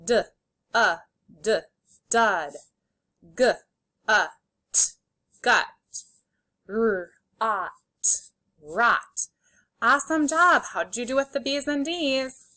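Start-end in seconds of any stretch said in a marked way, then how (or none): 0.0-2.5 "D, a, d" as isolated sounds, then "dud" whole; sounded out, blended. Shown as a demonstration. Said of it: "say the sounds and then blend". 3.4-5.7 "G, a, t" as isolated sounds, then "gut" whole; sounded out, blended. Shown as a demonstration. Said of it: "say the sounds and then blend". 6.7-9.3 "R, ot" as separate sounds, then "rot" whole; sounded out, blended. Shown as a demonstration. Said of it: "say the sounds and then blend".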